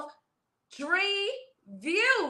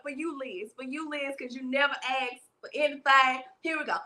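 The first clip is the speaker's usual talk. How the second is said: normal talking voice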